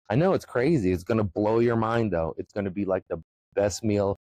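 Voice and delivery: usual speaking voice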